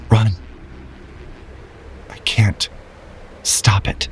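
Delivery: a frail croak sinking to a whisper. Acted. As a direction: rasp it out, barely any voice left. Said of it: "a croak of a voice".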